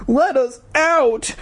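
put-on very high, slow panicked girl; frightened girl screaming at the freaks; shouting. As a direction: shriek to be let out. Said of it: "she shouted at them".

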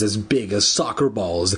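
plain reading voice

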